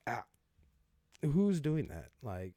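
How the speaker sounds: normal talking voice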